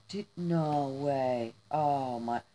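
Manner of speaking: low, slow, awed; stunned disbelief. Real, not acted. Reacting to a friend's surprise gift, stunned.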